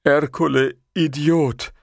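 put-on childlike, sheepish oaf; meek simpleton giant. A narrator's reading. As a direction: own up like a cowed dimwit. Giant meekly insults himself.

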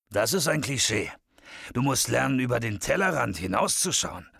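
lightly voiced tipsy bearded man, lecturing; a hint of a character voice, swooping in pitch; lecturing bearded leprechaun. Bearded man corrects Shadow's Guinness cliché, lecturing.

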